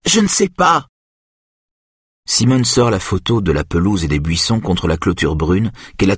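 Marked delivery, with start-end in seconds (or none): 0.1-0.9 high, insistent outcry; cried out. A narrator's reading. Aïda insisting she does not know, crying out. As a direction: cry it out high, falling at the end. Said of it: "crie Aïda".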